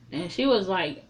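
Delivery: ordinary talk, nothing marked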